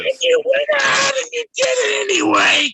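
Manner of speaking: tearful angry shout. Real, not acted. Drunken furious stream fight.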